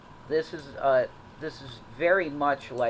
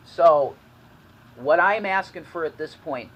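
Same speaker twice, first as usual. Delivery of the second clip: loud plea; 5 semitones higher, 10 dB louder than usual. Real, not acted. Begging viewers, voice surges.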